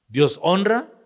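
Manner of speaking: ringing high question. Shown as a demonstration. Preacher repeating the question to the congregation, high and loud.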